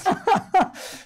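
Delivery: airy high-pitched laugh; high breathy laugh. Real, not acted. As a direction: burst into a breathy giggle. Laugh after joke.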